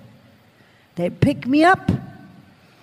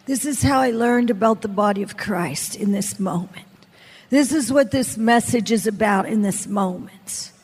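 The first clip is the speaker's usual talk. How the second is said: usual speaking voice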